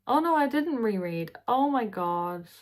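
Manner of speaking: sudden high loud oh no at herself; catching her own mistake, alarmed; embarrassed yelp of realization; real dismay. Real, not acted. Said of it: "I'm an idiot".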